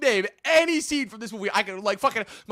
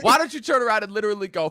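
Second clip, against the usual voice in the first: ordinary talk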